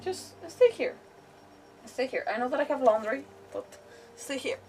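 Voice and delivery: ordinary talk, nothing marked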